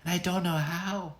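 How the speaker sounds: put-on a small frightened girl's voice; tearful girl. Girl in the locked room tells the little man, high and tearful. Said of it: "said the girl".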